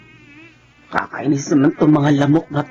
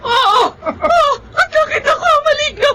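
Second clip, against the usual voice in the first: very high tearful shouting; panicked crying shout. Acted. Terrified man answering his brother's accusation, shrieking. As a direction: shriek it out, near tears.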